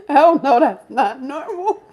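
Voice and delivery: loud, high, laughing outburst; laughing disbelief. Real, not acted. Teller recalls being eleven and baffled, laughing disbelief. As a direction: burst out laughing as you protest.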